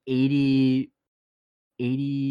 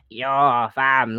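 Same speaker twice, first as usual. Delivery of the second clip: mock throaty Toronto accent; Toronto slang accent. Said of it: "what's wrong with your throat?".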